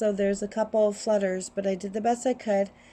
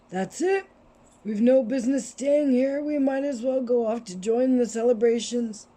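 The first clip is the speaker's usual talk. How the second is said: put-on wise old wizard voice; 3 semitones higher than usual. Headmaster ends the scene.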